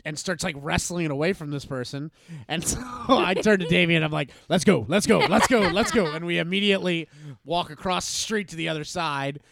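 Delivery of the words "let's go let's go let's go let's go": urgent let's go. Retold or imitated. Fleeing mugging, urging friend.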